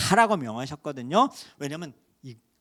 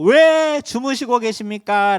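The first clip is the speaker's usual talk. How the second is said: slow, loud, raised cry of alarm; retold shout; 7 semitones higher, 10 dB louder, slower than usual. Disciples shouting at sleeping Jesus, loud slow cry. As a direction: call out to the sleeping master. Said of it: "이들이 소리를 지르고".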